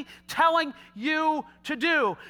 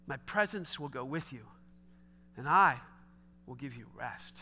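soft, low reassurance; 9 semitones lower, 7 dB quieter than usual. Retold or imitated. Preacher voicing God comforting an anxious Moses, hushed and low. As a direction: speak it low and tender, like a promise.

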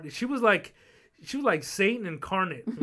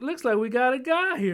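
higher, louder Southern sergeant tease; Southern sergeant teasing the recruit named Guy; 8 semitones higher, 5 dB louder than usual. Retold or imitated. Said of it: "southern draw".

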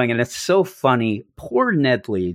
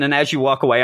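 ordinary delivery

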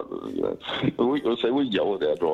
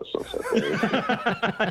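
mocking; 14 semitones higher, much slower than usual. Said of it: "I was like".

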